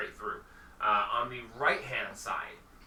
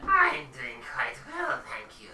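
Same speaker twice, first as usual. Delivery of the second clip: high shopkeeper voice; 4 dB louder than usual. Acted. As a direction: answer sweetly in a high falsetto.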